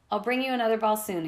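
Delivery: ordinary delivery, nothing marked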